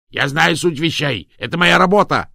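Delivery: high, loud cry of protest; outraged yell. A narrator's reading. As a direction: yell it out indignantly. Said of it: "завопил Атаба".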